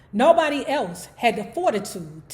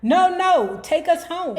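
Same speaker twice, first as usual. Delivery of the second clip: yelling; 6 semitones higher, 4 dB louder than usual. Retold or imitated. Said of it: "And I was yelling".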